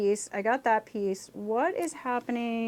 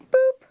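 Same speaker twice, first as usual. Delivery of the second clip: a bright little boop, pitched way up; high boop sound effect; 14 semitones higher, 7 dB louder than usual. Pretend. Building a Lego set on camera, a squeaky boop. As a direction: pop out a cartoon boop. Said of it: "You have to make those sound effects".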